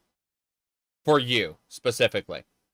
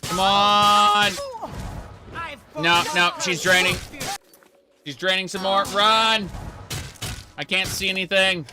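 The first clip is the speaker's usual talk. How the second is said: being chased; 3 semitones higher, slower than usual. Real, not acted. Fleeing enemies in game fight.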